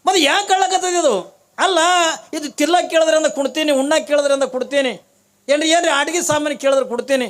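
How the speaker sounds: loud, high quarrelling voice; exasperated parent in a comic domestic row; acted exasperation. Quarrel over the crying child.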